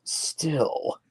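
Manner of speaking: hissed whisper; snake voice. Shown as a demonstration. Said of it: "my snake impression's kinda shit".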